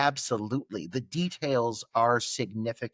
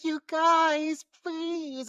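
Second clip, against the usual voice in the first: high, imitated desperate pleading; mock desperate begging, pitched way up; desperate plea; much slower than usual. Stripped woman pleading for her clothes, desperate. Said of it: "she sounds so, like, desperate".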